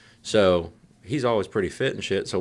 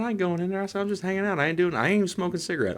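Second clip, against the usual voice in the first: put-on defiant teen; 11 semitones higher, faster than usual.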